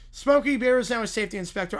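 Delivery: usual speaking voice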